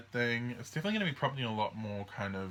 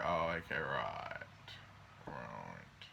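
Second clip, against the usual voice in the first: lower, sinking voice as it clicks; dawning realization; 9 semitones lower, 6 dB quieter, slower than usual. Retold or imitated. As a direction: drop low and soft as the penny drops. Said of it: "think of that energy and go".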